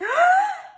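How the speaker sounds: loud high gasping inhale; shout-gasp before a sneeze; big sneeze gasp. Acted. Gerald gasping hard as a sneeze builds.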